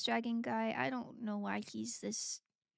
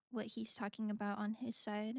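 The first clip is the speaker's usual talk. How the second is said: ordinary talk, nothing marked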